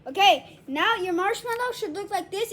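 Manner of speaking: ordinary talk, nothing marked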